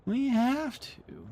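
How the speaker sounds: very high, slow whiny plea; pleading voice. Pretend. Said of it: "urging one more chapter".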